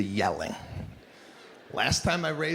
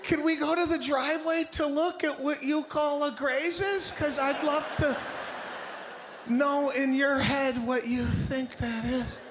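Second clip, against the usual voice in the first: much higher, strained, barely holding it; forced calm; 9 semitones higher than usual. Acted. Husband sarcastically questioning wife's graze, strained high. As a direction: keep the rage bottled, voice squeezed up high. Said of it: "I don't even sound like me".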